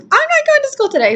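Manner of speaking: very high, whiny protest; high retold tantrum; upset refusal. Retelling her tearful refusal.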